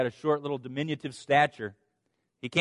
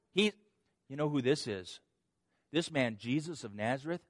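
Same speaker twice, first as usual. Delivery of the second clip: normal talking voice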